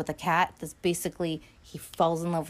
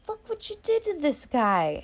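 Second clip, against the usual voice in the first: higher, lightly voiced scolding; retold accusation; 10 semitones higher than usual. Nicky confronting Ginger, mock scolding. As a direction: point out the damage, mock stern. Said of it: "he's like".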